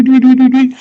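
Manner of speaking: high loud sung doo-doos; Jared juggles his glass balls; juggling sound effect. Retold or imitated. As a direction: chant it up high like a magic trick. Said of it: "Just, like, doo".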